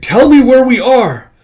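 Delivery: high, loud, insistent yell; shouted demand. Acted. Character in a quarrel demanding their location, angry shout. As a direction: demand an answer at full voice.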